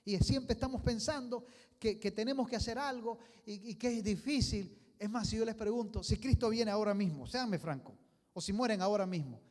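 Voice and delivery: loud, high, driving sermon; fiery preaching. Acted. Preacher challenging the church, loud and urgent. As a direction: press the congregation hard.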